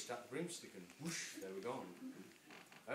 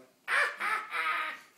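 high shrieking witch cackle; loud high witchy laugh; 14 dB louder than usual. Acted. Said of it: "The witch laughed aloud".

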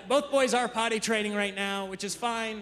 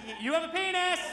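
put-on higher, pointed toddler accusation; accusatory little girl; 10 semitones higher than usual. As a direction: announce it like a tiny accuser. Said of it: "She's like".

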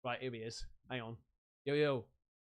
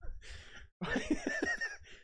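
high squeal laugh; 6 dB quieter than usual. Real, not acted. Laughs at own ten percent joke.

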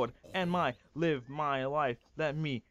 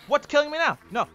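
high, loud alarmed cry; panicked yell; 13 semitones higher, 10 dB louder, much faster than usual. Real, not acted. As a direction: shriek it in confusion. Attacked again in game.

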